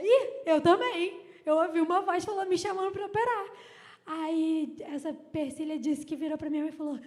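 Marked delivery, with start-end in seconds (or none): 0.0-3.4 loud, acted amazement; imitated excited exclamation, raised in volume; excited realization. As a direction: blurt it out as a thrilled discovery. Acting out excited recognition of the voice.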